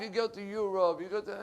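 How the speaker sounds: normal talking voice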